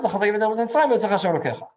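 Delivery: teacher stressing the verse's link; emphasis on remembering. Shown as a demonstration. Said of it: "the emphasis is on".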